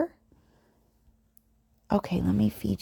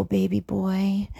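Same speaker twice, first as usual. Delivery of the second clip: usual speaking voice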